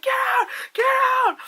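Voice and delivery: high-pitched shouted movie line; mock screaming warning; imitated frantic shout. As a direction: act out a frantic yell, loud and high. Acting out trailer's attack line.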